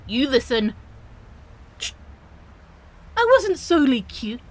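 ordinary talk

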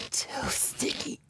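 breathy pleading whisper, barely voiced. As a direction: beg softly under the breath.

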